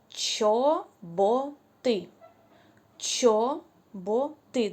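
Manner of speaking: «чоботи» stretched slowly, the «ч» clear; slow, drawn-out teaching pronunciation; drawn-out word. Shown as a demonstration. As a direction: say «чоботи» very slowly for the sound hunt. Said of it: "Де ви чуєте звук «ч»".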